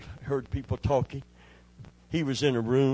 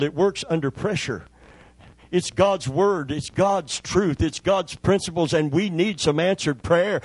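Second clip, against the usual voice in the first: louder, emphatic preaching; 3 semitones higher, 4 dB louder than usual. Acted. Preacher urging his church to pray in a crisis, emphatic and loud. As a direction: drive the point home to the congregation. Preacher builds to a strong point.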